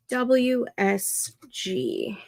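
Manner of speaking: usual speaking voice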